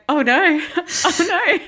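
high, loud, giggling through the oh no; dismay melting into laughter; laughing oh no. Retold or imitated. Remembering friend crying, laughing.

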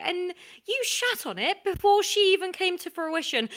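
hammed-up tirade, high and loud; mock outrage. Creator tells Harvey they've lost the starring role, mock outraged. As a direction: build into a loud, aggrieved rant. Mock quarrel over pitched show.

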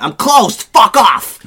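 loud, high shout to send them away; angry woman. Retold or imitated. Psychic in a dressing gown turning away a couple at her door, angry shout. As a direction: yell it like a furious door-slam. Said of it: "She was just an angry woman".

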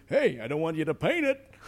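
high, loud wisecrack with a laugh in it; Rodney Dangerfield pig voice. Retold or imitated. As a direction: toss off the punchline like Dangerfield. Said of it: "who I modelled on Rodney Dangerfield".

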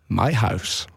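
lightly voiced old man, brief answer; old guy. Prank caller answers taxi dispatcher, mild old guy voice. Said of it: "pretend to be an old guy".

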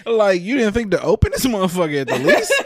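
loud, laughing, incredulous; laughing disbelief. Real, not acted. Roasting his wife to friends, loud and laughing. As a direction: howl it out in disbelief.

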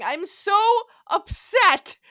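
mock upset wail. Quoting Janice freaking out in the episode, a hammed-up wail. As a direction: ham up a dramatic upset cry. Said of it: "She's just going".

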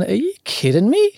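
high, disbelieving outburst; as if the deal were a joke; incredulous. Retold or imitated. Disbelief at getting one pill.

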